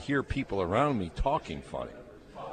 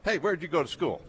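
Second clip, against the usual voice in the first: imitating Spurrier; 10 semitones higher than usual. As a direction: do a high-pitched impression of the coach. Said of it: "That's a pretty good imi-, imitation".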